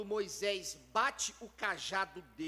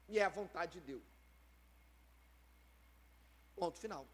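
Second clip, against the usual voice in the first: resigned; 5 semitones lower, 5 dB quieter, faster than usual. Retold or imitated. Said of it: "ela podia dizer assim".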